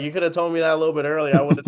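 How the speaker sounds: rushed, fast mock complaint; fast exasperated aside; I'm like. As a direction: rattle it off fast in disbelief. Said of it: "I'm like".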